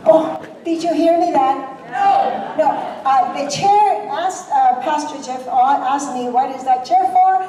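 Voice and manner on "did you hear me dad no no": usual speaking voice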